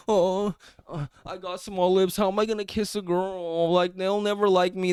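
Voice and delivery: mock whiny complaint. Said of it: "sitting here complaining".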